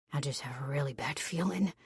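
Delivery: breathy whispered worry. A narrator's reading. Z confiding his bad feeling to her, whispered. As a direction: drop to a hushed whisper.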